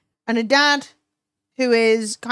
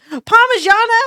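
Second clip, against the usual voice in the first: high, loud delighted query; excited high question; 10 semitones higher, 7 dB louder than usual. Real, not acted. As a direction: squeal the dish name. Excited food reading.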